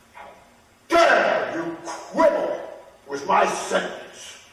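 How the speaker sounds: slow, loud, menacing royal rage; angry king. A narrator's reading. King threatening the quibbling shoemaker, angry.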